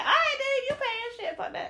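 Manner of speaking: very high-pitched, laughing retort; high, comic mock agreement; acted giving-in. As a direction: give in with voice shooting way up. Acted reply giving in to cousin.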